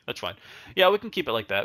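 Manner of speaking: ordinary talk, nothing marked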